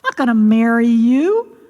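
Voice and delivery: high, scornful outburst at the frog; disgusted princess. Acted. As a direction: scoff at the ugly frog. Daughter insults frog.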